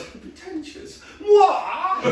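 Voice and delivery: high, affronted "Pretentious? What?"; indignant posh mum. Retold or imitated. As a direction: mum offended at being called pretentious, shrill and high. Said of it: "And she goes".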